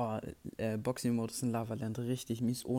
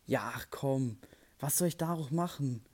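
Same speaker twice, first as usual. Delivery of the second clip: normal talking voice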